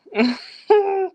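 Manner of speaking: sudden high burst of laughter. Real, not acted. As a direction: break into a high giggle. Laughs at own confession.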